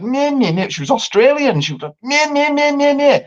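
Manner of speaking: high nagging meh meh; mocking whine. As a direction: parody her drunk rudeness. Mocking drunk Australian date.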